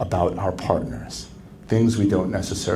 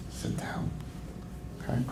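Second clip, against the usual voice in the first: whisper; 17 dB quieter than usual. Shown as a demonstration. Advice on anger.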